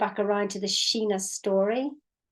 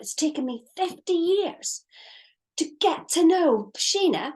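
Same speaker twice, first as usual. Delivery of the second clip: indignant outburst; 6 semitones higher than usual. Retold or imitated. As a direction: relive the outrage at being told you don't exist. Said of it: "I was so angry".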